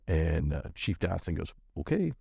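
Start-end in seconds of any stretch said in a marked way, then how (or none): 1.8-2.1 imitated wow-really reaction; high, questioning okay; incredulous. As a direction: mimic a boss taken aback. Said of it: "kinda like, Wow, like, really?".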